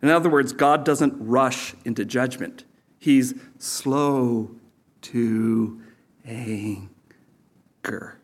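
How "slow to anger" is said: very slow, each word set apart with long pauses; drawn-out slowness. Shown as a demonstration. Preacher spelling out God's patience to the congregation, slow and drawn-out. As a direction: stretch it out, sinking softly on the last word.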